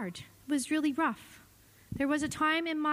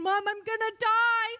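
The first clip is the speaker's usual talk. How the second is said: imitating a frightened son; 8 semitones higher than usual. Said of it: "He kept saying".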